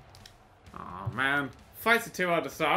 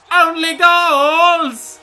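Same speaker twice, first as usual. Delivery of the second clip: mock shout; 10 semitones higher, 11 dB louder, much slower than usual. Real, not acted. Mocking referees, loud exclamation.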